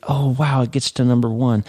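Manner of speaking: high mock thrill at the news; delighted. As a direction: lift it like a happy surprise. Book hits number one.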